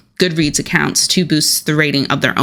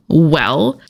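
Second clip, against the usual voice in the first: usual speaking voice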